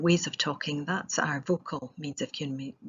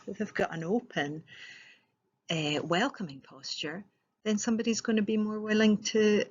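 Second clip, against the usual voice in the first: usual speaking voice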